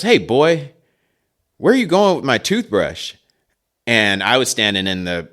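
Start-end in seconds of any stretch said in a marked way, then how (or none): 0.0-3.1 dad's challenge. Retold or imitated. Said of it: "and he goes".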